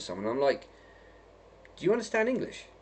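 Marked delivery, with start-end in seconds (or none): 1.8-2.7 incredulous question. Retold or imitated. Asking strangers in a bar.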